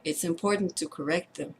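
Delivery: quietly comfort, one phrase at a time; hushed, tender retelling of a child's forgiveness; gentle reassurance. Retold or imitated. Child comforts mother after her confession.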